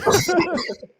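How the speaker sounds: high squealing laugh. Real, not acted.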